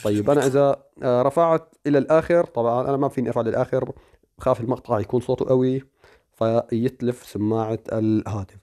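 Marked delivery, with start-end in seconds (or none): none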